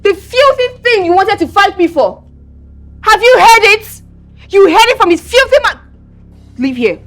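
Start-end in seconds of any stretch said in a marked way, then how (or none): 0.0-5.8 high-pitched, scornful tirade; angry contempt. Acted. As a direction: spit out your disgust in a raised pitch. Sneering tirade in an acted quarrel.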